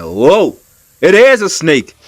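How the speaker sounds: loud, high cry of alarm; startled shock. Retold or imitated. Man retelling the moment he saw the snake, startled. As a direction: re-enact the jolt of spotting danger.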